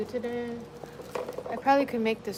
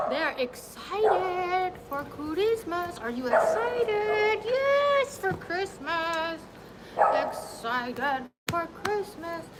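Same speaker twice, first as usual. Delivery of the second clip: high, sing-song pet voice; 7 semitones higher, 4 dB quieter, slower than usual. Real, not acted. Hyping dogs up.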